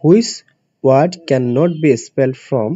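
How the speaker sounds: very slow, each word spaced for students; slow dictation. Shown as a demonstration. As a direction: read it out slowly so they can follow. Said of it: "of the following". (ধীরে ধীরে পড়ে শোনানো)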